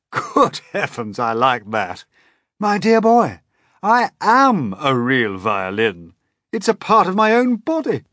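put-on old grasshopper. A narrator's reading.

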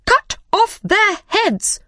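very high, drawn-out royal command; very loud shout. Acted. Queen suddenly ordering executions, shouting very loudly. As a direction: shriek each word separately. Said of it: "the Queen shouted very loudly".

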